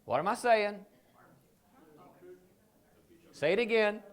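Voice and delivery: loud call-and-response prompt; calling to audience. Real, not acted. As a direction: call it out for the crowd to answer.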